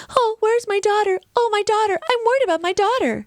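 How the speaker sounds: high-pitched, badly dubbed worried mother. Podcaster mimicking a badly dubbed mother for her co-hosts, high mock worry. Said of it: "dubbed voice".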